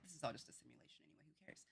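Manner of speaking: fast careless mutter. Retold or imitated. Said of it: "You're just like".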